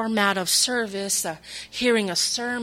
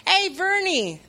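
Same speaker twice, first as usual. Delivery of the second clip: cheery friend; 10 semitones higher, 4 dB louder, slower than usual. Retold or imitated. Friends calling on phone.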